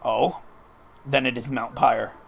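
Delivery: restrained, slightly low villain read; a hint of stiff formality; lightly voiced formal villain. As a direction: play the courteous villain excusing himself, understated. Voices game villain Maxie's dialogue.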